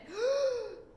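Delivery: high loud crowd gasp; astonished gasp. A narrator's reading. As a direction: gasp in sheer amazement. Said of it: "a great gasp of astonishment".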